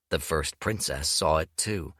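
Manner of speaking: ordinary delivery, nothing marked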